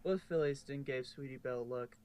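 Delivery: ordinary delivery, nothing marked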